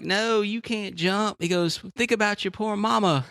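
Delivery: high, slow plea not to jump; pleading passerby. Retold or imitated. Passerby begs the jumper on the overpass, high pleading. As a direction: beg him to think of his mama.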